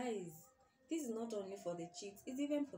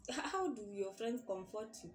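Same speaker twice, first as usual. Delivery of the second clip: ordinary delivery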